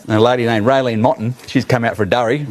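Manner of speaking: ordinary talk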